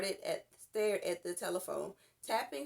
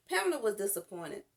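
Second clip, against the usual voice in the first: ordinary delivery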